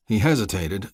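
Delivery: ordinary delivery, nothing marked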